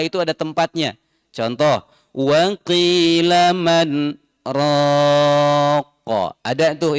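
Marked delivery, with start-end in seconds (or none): 2.1-6.3 "qila man" chanted slow with a brief stop; saktah example. Shown as a demonstration. Teacher reciting a proper saktah example. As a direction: mark the saktah pause. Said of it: "itu bacaan saktah".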